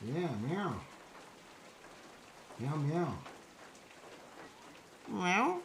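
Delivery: high soft meowing at the cat; soft high meows to a cat. Pretend. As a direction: meow back at the kitty, high and gentle.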